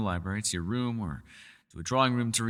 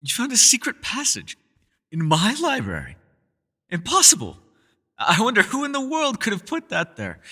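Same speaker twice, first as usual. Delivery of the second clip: hammed-up gasp of disbelief, much higher and louder; mock astonishment; 11 semitones higher, 6 dB louder than usual. Host teasing the guest who found his passage, mock astonishment. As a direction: play the shock as a teasing joke. Playful feigned shock at discovery.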